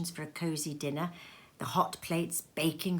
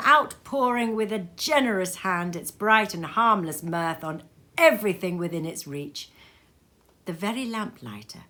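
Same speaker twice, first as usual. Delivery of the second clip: plain reading voice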